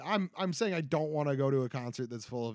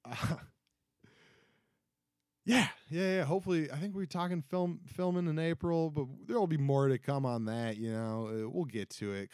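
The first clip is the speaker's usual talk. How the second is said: normal talking voice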